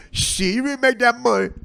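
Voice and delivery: high-pitched mimicry of her; imitated incredulous woman. Woman questions the comedian's income, imitated incredulity. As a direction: quote her with exaggerated disbelief. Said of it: "She goes".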